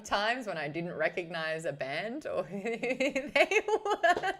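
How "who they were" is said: slow, high words dissolving into laughter; real laughing. Real, not acted. Host admitting she didn't recognise bands, laughing. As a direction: laugh through the end of the sentence. Laughs at own past ignorance.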